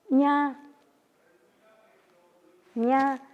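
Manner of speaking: "ña" alto y sostenido; tono de "oscuro". Shown as a demonstration. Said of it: "estoy diciendo oscuro". ("ña" con tono de oscuro)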